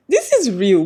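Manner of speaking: loud, emphatic burst of disbelief; amazed reaction. Retold or imitated. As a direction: exclaim it loudly, stunned at what you watched. Acting out own shocked reaction.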